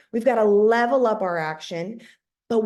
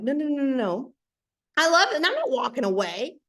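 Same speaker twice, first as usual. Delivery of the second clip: angry refusal; 5 semitones higher than usual. Retold or imitated. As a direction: refuse to give up, fired up. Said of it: "I had to get angry again".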